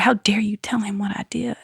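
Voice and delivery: hushed, angry whisper; furious whisper mid-service; whispered accusation. Retold or imitated. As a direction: hiss it so the church won't hear.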